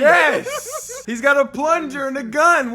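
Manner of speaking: high, loud, laughing delight; laughing excitedly. Real, not acted. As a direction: burst out laughing with glee. Friend reveals his protagonist drawing.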